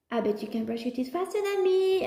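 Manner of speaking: lightly voiced teasing mom; playful challenge. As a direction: dare the kid into the game. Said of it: "I just have to say".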